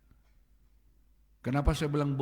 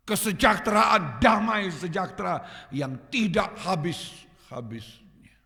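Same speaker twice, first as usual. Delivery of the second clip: higher and louder, swelling on each blessing; preaching God's promise at full force; 5 semitones higher, 7 dB louder than usual. Retold or imitated. Preacher voicing God's promise to the church, building to loud. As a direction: proclaim it like a rising promise. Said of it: "Tuhan masih bilang".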